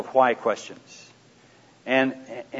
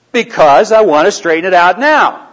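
mock defensive snap, higher and louder; irritated husband snapping back; irritated retort; 6 semitones higher, 10 dB louder than usual. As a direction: replay the flash of irritation at your wife. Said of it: "Well, don't get angry about it".

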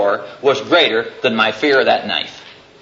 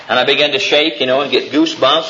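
ordinary talk, nothing marked

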